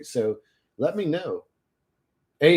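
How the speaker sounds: ordinary talk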